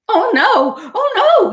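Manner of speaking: high, loud panicked oh no; yelled. A narrator's reading. Duck panicking at Raccoon's storm idea, high yelling. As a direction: wail it out as a frightened duck. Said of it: "yelled Duck".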